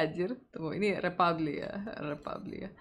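normal talking voice

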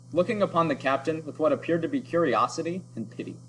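ordinary delivery, nothing marked

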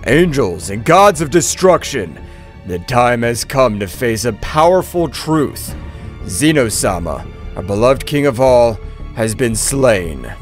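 low, slow, solemn announcement; calm high priest. A narrator's reading. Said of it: "with his usual calmness".

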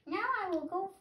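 high, small chick voice, lost and asking; a baby bird piping for its mother. Acted. Hatchling searching for its mother, high piping voice. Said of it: "my baby bird voice".

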